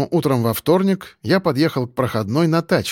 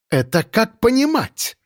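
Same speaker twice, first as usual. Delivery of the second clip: high, slow, indignant demand; angry woman boss; angry growl; 9 semitones higher than usual. A narrator's reading. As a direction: demand an explanation, stretching it angrily. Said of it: "зло прорычала ткачиха".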